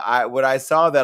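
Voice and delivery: ordinary talk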